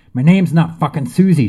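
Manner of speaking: high, loud furious yell; screamed. Acted. Jane shouting back at the police outside, screaming in anger. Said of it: "she screamed".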